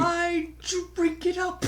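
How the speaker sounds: high-pitched theatrical echo of a film line; hammed-up, drawn-up quote; mock villain catchphrase. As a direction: mimic a gloating movie villain. Echoing the milkshake line.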